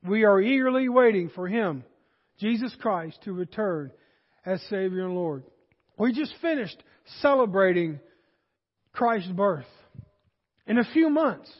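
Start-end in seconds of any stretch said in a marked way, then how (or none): none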